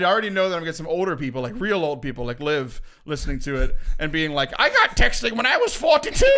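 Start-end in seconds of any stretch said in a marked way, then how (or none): 4.6-6.3 put-on higher, louder old-lady voice; imitating Liv. Host imitating co-worker Liv for listeners: higher voice. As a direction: mimic an older woman bragging. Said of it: "That was a really good Liv impersonation".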